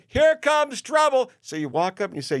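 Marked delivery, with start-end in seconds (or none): 0.1-1.3 much higher, louder and slow, a brassy hostess call; hammed-up hotel clerk hollering at a guest; Messy Jessie greeting. Hotel clerk Messy Jessie greets an arriving guest, loud and high. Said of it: "she's pointing at you".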